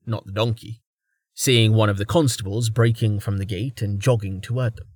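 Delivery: ordinary delivery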